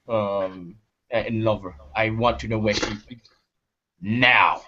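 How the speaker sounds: low, halting character voice; grim protector. Acted. Raksha revealing himself and demanding his lover's whereabouts, low grim voice. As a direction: drop low, hesitate, then press the demand. Player speaks as hooded Raksha.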